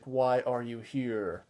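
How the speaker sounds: slow, drawn-out voice from out of nowhere; slow ghostly voice calling from the dark; Peter Cushing voice. Retold or imitated. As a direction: stretch each word like an unseen figure calling out. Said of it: "you just hear this voice".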